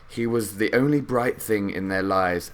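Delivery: ordinary delivery, nothing marked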